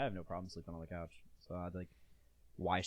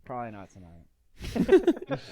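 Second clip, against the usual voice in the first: resigned sigh; 14 dB louder, much slower than usual. Acted. Slow resigned drawl after sigh.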